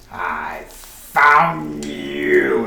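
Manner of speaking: villainous slow-motion yell; witch's yell. Acted. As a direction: stretch each word into a slow menacing call. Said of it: "And she yells out".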